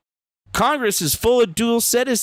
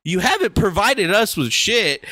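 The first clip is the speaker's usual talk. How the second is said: usual speaking voice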